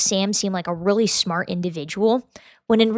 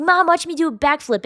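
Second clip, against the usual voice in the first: high-pitched imitation of a kid; show-off kid; 7 semitones higher than usual. Six-year-old showing mom a backflip, high mock-kid voice. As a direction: call to mom like a little kid wanting attention. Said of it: "like a kid showing their mom".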